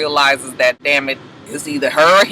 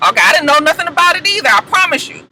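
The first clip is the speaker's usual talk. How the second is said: much higher, fast, near-shouted panic; panicked denial; 11 semitones higher, 3 dB louder than usual. Retold or imitated. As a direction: plead your innocence in a high rush. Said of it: "And Andre was just like".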